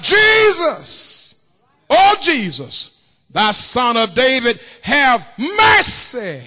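high, loud pleading cry to Jesus. Retold or imitated. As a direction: call out desperately, drawing out Jesus. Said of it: "and he cried out".

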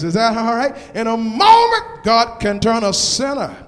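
loud rising preacher's call, building; preaching at full force. Acted. Pastor calling out to the congregation, preaching at full force. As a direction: whoop the promises at the crowd.

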